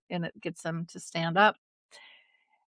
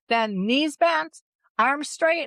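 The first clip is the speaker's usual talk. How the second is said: higher, louder coach instructions; ski coach call; 7 semitones higher, 4 dB louder, slower than usual. Retold or imitated. Daughter coaching her mom like a ski instructor, high and loud.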